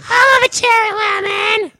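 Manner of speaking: put-on very high, squeezed little-kid voice; smoker kid voice. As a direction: play a child who sounds like a smoker. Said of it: "why does the little kid sound like he might be, like, smoking".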